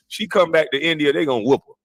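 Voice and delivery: ordinary talk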